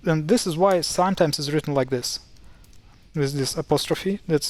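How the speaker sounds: normal talking voice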